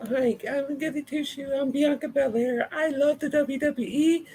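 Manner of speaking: mock goody two-shoes, very high voice; high, relentless mock-cheerful babble; Bianca Belair. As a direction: gush in a sickly-sweet falsetto parody. Mocking Bianca's sweet fan-pleasing promos.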